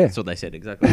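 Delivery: ordinary delivery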